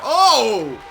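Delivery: loud burst of excitement; excited shout. Real, not acted. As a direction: yell it out as the upset lands. Crowd upset moment, shout.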